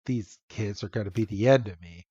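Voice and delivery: low, soft acted grumble; weary aside. Trip despairing of the kids, retold as weary aside. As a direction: say it under the breath, long-suffering.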